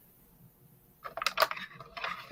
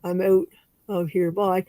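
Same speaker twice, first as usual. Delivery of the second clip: ordinary delivery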